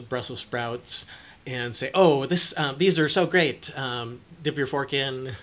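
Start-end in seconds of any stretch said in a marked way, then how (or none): 1.9-3.7 higher, louder over-the-top praise; exaggerated delight. Parent at dinner table, exaggerated delight. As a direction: ham it up with the fork in the sprouts. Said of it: "over-exaggeratedly".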